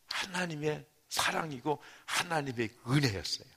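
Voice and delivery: ordinary talk, nothing marked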